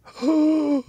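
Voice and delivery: high loud gasp of shock; sharp gasp. Retold or imitated. Spotting the huge anaconda at night, gasping. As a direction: gasp as if seeing the snake now.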